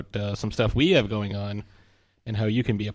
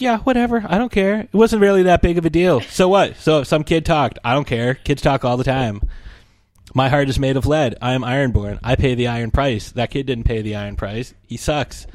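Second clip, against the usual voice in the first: dismissive mock; 2 semitones higher than usual. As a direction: brush it off like a cold-hearted friend. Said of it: "And Nicole's like".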